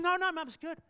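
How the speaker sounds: put-on girl reassuring her mother, excited; excited little girl. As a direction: burst in with the good news. Daughter voiced in retold story.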